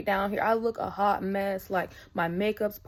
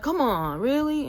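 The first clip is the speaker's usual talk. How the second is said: louder, whiny imitated objection; reluctant protest; 5 semitones higher, 4 dB louder than usual. Store worker told to drive her home, reluctant protest. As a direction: push back, pitch jumping up on the question.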